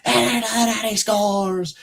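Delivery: high, slow excited announcer call; Bowen. Retold or imitated. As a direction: belt it like a thrilled play-by-play man. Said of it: "He's like".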